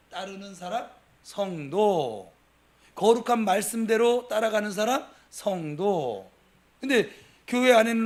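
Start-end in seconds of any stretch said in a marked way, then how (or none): 1.2-2.3 성도 drawn out very slowly; slow emphatic word. Real, not acted. Preacher defining a saint to the congregation, slow and weighty. 5.3-6.3 성도 drawn out very slowly; slow emphatic word. Real, not acted. Preacher defining a saint to the congregation, slow and weighty.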